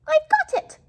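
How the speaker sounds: high, loud excited squeak of a voice; a tiny mouse bursting with an idea; little mouse exclaiming. Acted. Mouse to himself at night, excited high squeak. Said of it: "he said".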